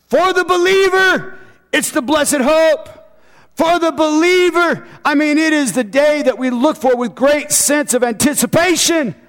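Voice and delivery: high, ringing preacher's proclamation; full-force preaching. Real, not acted. Preacher proclaiming the believer's blessed hope, in high full-force preaching. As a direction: proclaim it high and hard to the church.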